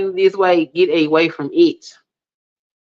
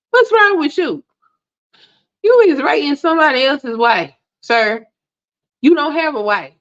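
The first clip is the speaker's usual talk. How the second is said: exasperated scolding; 7 semitones higher than usual. Real, not acted. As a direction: tell him off, outraged and disbelieving. Scolding an online commenter.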